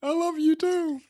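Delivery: high, loud hammed-up reply; a hammed-up wife swooning, high and loud; mock-tender. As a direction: gush it back in a high squeal. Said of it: "You're gonna make me cry".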